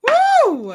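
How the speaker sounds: high, loud whoop that falls away; loud venting woo. Real, not acted. Host releasing her anger, loud high whoop. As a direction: blow off steam with a big "woo". Letting out tension.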